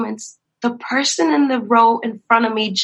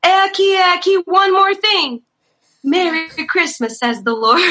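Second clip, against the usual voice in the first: hammed-up prophetess; mock prophecy; 7 semitones higher than usual. Prophetess calling out the pastor by name, mock proclaiming. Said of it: "And then she goes".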